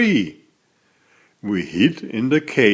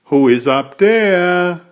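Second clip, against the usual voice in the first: louder, higher cry up the hole; cried out; 5 semitones higher, 5 dB louder than usual. A narrator's reading. Joey shouts up the chimney at a hidden stranger, loud cry. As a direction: shout the question up into the dark. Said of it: "and cried".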